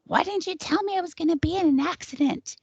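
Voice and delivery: high-pitched accusing shriek, held back in volume; mock screaming. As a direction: imitate her screaming in outrage. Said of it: "She's screaming".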